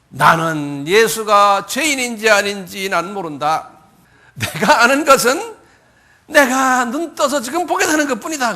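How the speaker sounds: high, loud, drawn-out declaration; rising insistence, stretched words; emphatic acted testimony. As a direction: declare it slowly and forcefully as the healed man. Acting the healed man's testimony.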